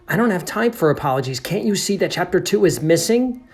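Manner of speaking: high, loud, rushed exasperation; exasperated narrator. Acted. Narrator brushes off the janitor's demand, exasperated. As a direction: climb to an indignant question.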